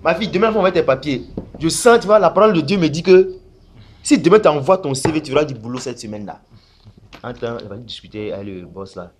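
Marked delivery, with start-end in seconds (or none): 0.0-6.4 louder, higher mock preacher prophecy; hammed-up pastor voice; prophesying pastor. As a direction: promise the girl a job in God's name. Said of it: "Je vais dire à la fille".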